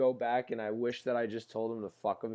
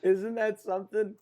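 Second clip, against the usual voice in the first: laughing; 9 semitones higher, 8 dB louder than usual. Real, not acted. Still laughing at the story.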